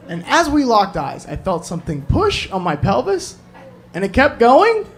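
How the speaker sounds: ordinary talk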